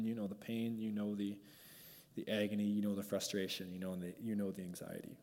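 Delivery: soft, low pastoral prayer to Jesus; spoken prayer. Acted. Pastor prays over the hurting congregation, soft and low.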